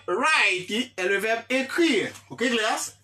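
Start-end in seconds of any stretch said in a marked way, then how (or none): none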